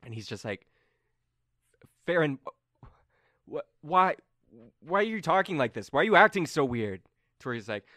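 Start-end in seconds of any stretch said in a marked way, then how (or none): 2.1-7.0 higher, stammering confusion; Colm hurt. Acted. Game master as Colm pleading with Farron, higher and hurt. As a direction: stumble through the why, hurt.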